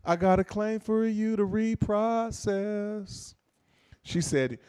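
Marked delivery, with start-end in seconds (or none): 0.0-3.3 high, hugely stretched vowels; mocking, very slow sing-out; mimicking slow speech. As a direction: copy her drawn-out way of talking. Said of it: "Why are you talking like that?".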